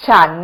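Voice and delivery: ฉัน said alone with a rising tone. Shown as a demonstration. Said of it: "Can you hear my sounds?".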